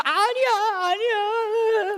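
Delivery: high, slow, wailing sorry; imitated crying apology. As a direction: blubber out an apology. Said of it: "저도 막 같이 울고".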